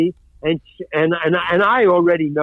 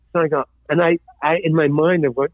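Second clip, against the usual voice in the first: usual speaking voice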